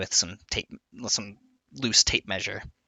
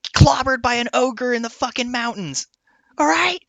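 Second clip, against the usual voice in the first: furious dwarf rant; 12 semitones higher than usual. Acted. Dwarf smith snapping at a customer over titanium, much higher furious rant. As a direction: blow up at the customer in a high strained rage. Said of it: "vein bulge on his thing. He's like".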